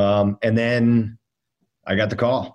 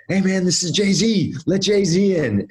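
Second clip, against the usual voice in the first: high, eager hype-man voice, pitched way up; mock pushy buddy; 9 semitones higher than usual. Rope-side friend pleading with bouncer, high eager hype voice. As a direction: sell the bouncer hard, voice jumped high.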